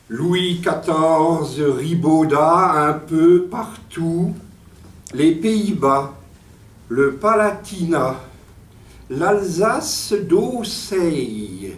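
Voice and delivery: slow, drawn-out poetry declamation; déclamation poétique. Acted. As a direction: declaim it line by line, stretching the final words. Lit son poème à voix haute. (déclamation poétique lente, mots étirés)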